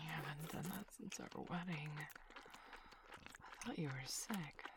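groggy, half-asleep whisper, slow; sleepy whisper. Acted. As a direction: mumble it as if still waking up. Just woken, groggy in bed.

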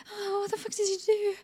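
usual speaking voice

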